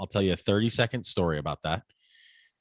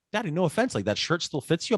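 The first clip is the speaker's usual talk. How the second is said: put-on light, higher kid voice; imitating his son; 7 semitones higher than usual. Son teasing dad about an old shirt, light kid voice. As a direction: play the son, gently teasing. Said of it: "My son says".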